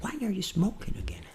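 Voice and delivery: very soft practice take; intimate voice. Shown as a demonstration. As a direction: say it hushed, to yourself. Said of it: "voz íntima". (voz íntima, bem baixinha)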